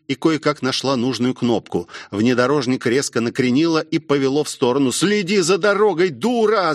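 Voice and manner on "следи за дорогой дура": mild, high-pitched yell; restrained urgent shout. A narrator's reading. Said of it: "закричал Ямада".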